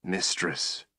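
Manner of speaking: a soft, pained whisper; softly whispered in defeat. A narrator's reading. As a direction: breathe the word in pain. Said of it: "he whispered".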